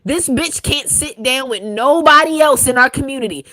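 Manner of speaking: shouting in disbelief at the choice; angry rant. Real, not acted. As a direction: go off, loud and fed up. Continued furious rant.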